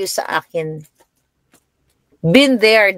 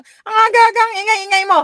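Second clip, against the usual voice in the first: complaining daughter. Retold or imitated. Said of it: "Sabi ko".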